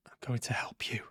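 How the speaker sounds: soft, hushed reassurance; calm them down in a whisper; reassuring whisper. Acted.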